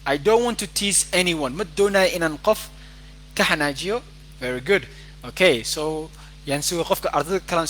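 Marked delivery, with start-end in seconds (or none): none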